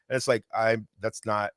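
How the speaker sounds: ordinary talk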